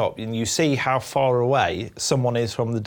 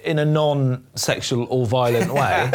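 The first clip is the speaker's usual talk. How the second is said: normal talking voice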